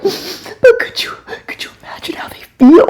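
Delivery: high whispered imitation of her daughter; whispered daughter plea. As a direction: breathe it as the tender-hearted daughter. Daughter pleading, voiced in story.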